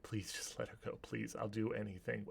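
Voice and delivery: very soft, understated plea; begging. Acted. Alfred begs Bertram for his wife, soft begging. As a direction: plead weakly under your breath. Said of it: "he's begging and he's crying".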